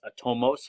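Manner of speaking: "atomos" as uh-TOE-mose, ending in s; pronunciation variant "atomos". Shown as a demonstration. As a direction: stress the long "toe" in "atomos", closing on s. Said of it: "I've heard it pronounced".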